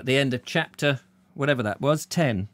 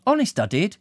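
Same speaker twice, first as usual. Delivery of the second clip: higher, pleading woman's voice; young woman voice; 9 semitones higher than usual. Acted. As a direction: insist sweetly. Blonde pleads.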